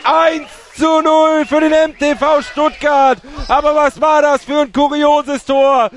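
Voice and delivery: screaming goal commentary. Real, not acted. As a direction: roar out the goal, drawing words out. Goal scored, commentator erupts. (schreiender Torjubel-Kommentar)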